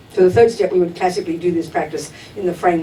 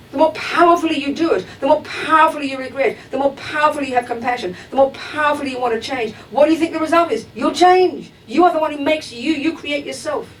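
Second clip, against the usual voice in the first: raised pitch, insistent rhythmic teaching; emphatic preaching; 8 semitones higher than usual. Real, not acted. Teacher urging students that effort changes them, emphatic preaching. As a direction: drive each parallel phrase home, pitched high.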